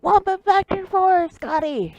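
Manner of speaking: high, drawn-out loud order; captain's shout. Acted. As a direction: shout the order down to the engine room. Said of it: "shouts Smirk".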